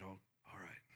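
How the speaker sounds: hushed, barely voiced whisper; nervous whisper. Nervous neighbor agreeing to prayer, whispered. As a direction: whisper it so nobody overhears.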